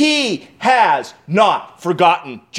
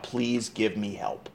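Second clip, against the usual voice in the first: much softer, slow begging; quiet plea; 6 semitones lower, 12 dB quieter, slower than usual. Acted. King pleading softly to God for help, quiet. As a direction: beg God for help almost under the breath. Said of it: "So he pleads to God".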